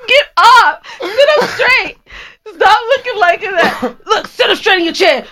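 put-on stern old-school man. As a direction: bark orders like a strict old grandpa. Old-school man scolding young man.

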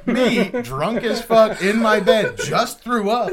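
loud laughing disbelief. Real, not acted. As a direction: talk through laughter, high and loud. Laughing retelling.

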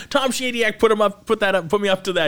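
high, loud, giggly quote; quoting the actress. Retold or imitated. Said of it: "She's like".

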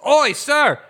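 loud, high, badly faked Scottish; attempted Scottish accent. Small figure hailing the party on the ship, attempted Scottish accent. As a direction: call out in a shaky attempt at Scottish. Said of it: "I cannot do a Scottish accent".

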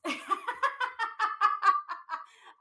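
loud, rolling laughter; long delighted laugh. Real, not acted. Host laughing at her daughter's crayon line, delighted laugh.

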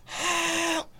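very high shrieked cry for help; mock nightmare scream. Dad imitating his daughter nightmare screaming, very high mock shriek. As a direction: shriek it like a terrified child. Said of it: "This girl was nightmare screaming".

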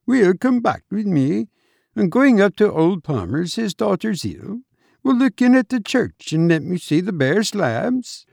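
amused Irish doctor's voice; Irish accent. A narrator's reading. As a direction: chide in a lilting Irish brogue. Narrator plays the Irish doctor.

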